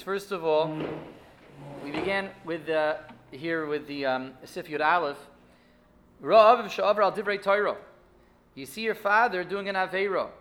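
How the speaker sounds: ordinary talk, nothing marked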